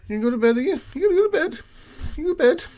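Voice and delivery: high, loud cooing question to the cat; doting pet voice. Real, not acted. Baby-talking the cat.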